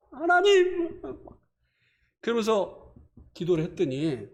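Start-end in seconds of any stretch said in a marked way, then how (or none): 0.1-1.0 a high, half-laughing wail of God's name; mock tearful cry. Pastor imitating himself crying out to God in prayer, mock tearful high wail. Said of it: "막 울면서 막 그냥".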